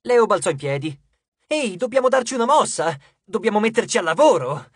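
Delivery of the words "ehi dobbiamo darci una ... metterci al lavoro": loud, high-pitched burst of energy; urgent rallying. A narrator's reading. Leo jumping up to get the crew working, urgent rallying. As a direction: chivvy everyone into action.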